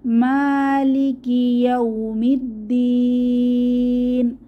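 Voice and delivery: slow chanted verse ending on a long stretch; tajweed reading; Quran recitation. Shown as a demonstration. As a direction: recite slowly, hold the last vowel. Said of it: "তিন আলিফ টেনে ওয়াকফ করব".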